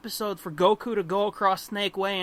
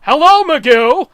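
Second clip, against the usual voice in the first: loud, higher cartoon greeting; redubbed Charlie voice; 6 semitones higher, 14 dB louder than usual. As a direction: chirp a bright dubbed hello. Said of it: "And then you hear".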